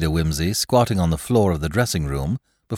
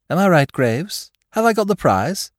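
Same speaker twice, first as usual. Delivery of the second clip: high, louder triumphant question; triumphant Lord Peter; 12 semitones higher, 5 dB louder than usual. Acted. Lord Peter asking Graves if he won, high and pleased. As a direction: claim the prize, rising high.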